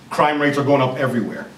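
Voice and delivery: normal talking voice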